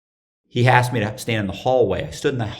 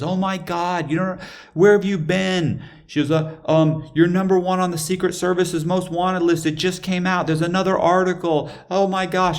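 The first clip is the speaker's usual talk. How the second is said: high-pitched mock-panicked woman; girlfriend freaking out; 9 semitones higher than usual. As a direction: imitate her breathless news, pitched way up. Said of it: "And she's freaking out".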